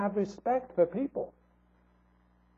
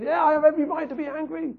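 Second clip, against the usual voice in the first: sulky tantrum; 8 semitones higher, faster than usual. Retold or imitated. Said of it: "stamping his foot and throwing a tantrum".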